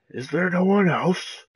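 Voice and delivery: loud, drawn-out rising call; theatrical shouted question; mock challenge. Mock challenge calling out for takers.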